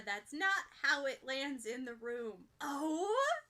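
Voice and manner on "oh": higher squeaky oh; little high gossip squeak. A narrator's reading. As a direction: squeak with delighted surprise. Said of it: "squeaks Mina".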